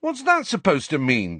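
high-pitched affronted question; indignant. A narrator's reading. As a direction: protest as if insulted, voice shooting up. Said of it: "said Ron indignantly".

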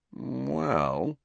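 sceptical, wavering pitch; thoroughly unconvinced. A narrator's reading. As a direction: stretch the word with open doubt. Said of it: "sounding thoroughly unconvinced".

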